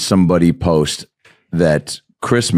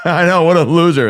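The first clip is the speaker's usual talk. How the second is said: झूठी हामी; 9 semitones higher than usual. Retold or imitated. Retold fake joining in the mockery.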